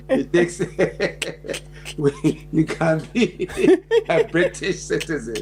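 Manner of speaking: chuckling out the words; laughing through it. Real, not acted. Explaining British citizenship to Smiley while laughing. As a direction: let the laugh break the line.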